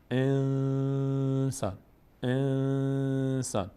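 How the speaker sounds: انسان with the ن held long as a nasal hum; nasal hidden noon. Shown as a demonstration. Teacher showing students the noon hidden in the nose, long nasal hum.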